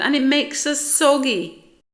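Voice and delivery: high, loud wail of dismay; distressed, high-pitched protest; crying out. A narrator's reading. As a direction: wail the last complaint loudly. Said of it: "they cried together".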